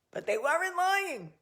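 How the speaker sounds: high, exaggerated comeback; high, hammed-up storyteller's aside; mock incredulous echo. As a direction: pitch it up high, echoing the disbelief. Echoes the exaggerated retort.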